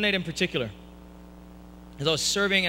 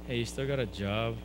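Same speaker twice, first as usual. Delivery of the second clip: sheepish request; 5 semitones lower, 4 dB quieter than usual. Retold or imitated. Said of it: "as a failure, I walked back to Dad".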